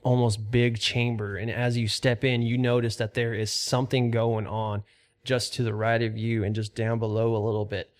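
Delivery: steady, measured scene-setting; lightly dramatic dungeon description; game master narration. Acted. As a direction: paint the chamber for the players. GM sets the scene.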